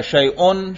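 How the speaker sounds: شیئن pronounced "shay-un"; damma ending. Shown as a demonstration. Teacher showing hamza takes every case, "-un" ending. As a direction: end شیئن on a clear "-un". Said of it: "حمزہ کے اوپر تینوں اعراب".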